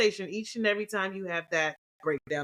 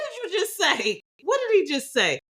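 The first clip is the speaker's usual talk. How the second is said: high, disbelieving what-did-he-say; shocked and louder, voice way up; incredulous outburst; 10 semitones higher, 3 dB louder than usual. Retold or imitated. Wife stunned by husband's words, high disbelief.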